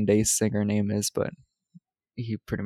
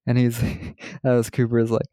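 usual speaking voice

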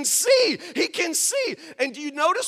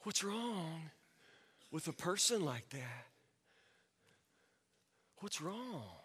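very soft, halting question; almost to yourself, with long pauses; quiet, baffled murmur; hushed dismay; 6 semitones lower, 15 dB quieter, slower than usual. Acted. Hushed dismay after the anecdote.

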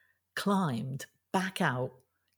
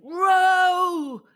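high, loud, sustained yell; shout; 14 semitones higher, 10 dB louder than usual. Acted. Finn urges the sisters to flee the giant, shouting. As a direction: call out the order at full stretch. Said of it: "shouted Finn".

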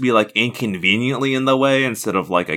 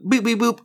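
phone beep noises; 10 semitones higher than usual. Pretend. Fakes a phone ringing.

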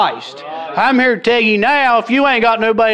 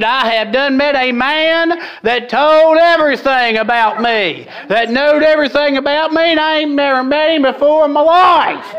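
excited woman at the well; 7 semitones higher than usual. Retold or imitated. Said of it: "she looked at everybody and she said".

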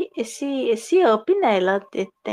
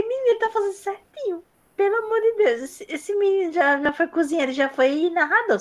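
espanto recontado; 7 semitones higher than usual. Retold or imitated. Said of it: "eu falei".

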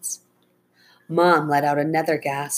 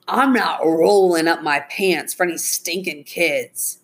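slow, defiant refusal in character; grudging tough-guy refusal, leaning on the opening; stubborn driver; 2 semitones higher, 3 dB louder than usual. Acted. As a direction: dig in as a scornful crook, dragging words.

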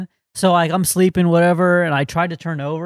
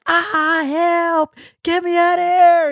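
high mock scream for help; panicked yell from inside the bed; retold screaming; 5 dB louder, much slower than usual. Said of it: "And I start screaming. I'm like".

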